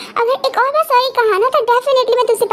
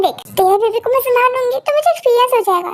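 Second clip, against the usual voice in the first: ordinary talk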